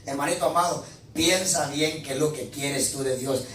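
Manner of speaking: usual speaking voice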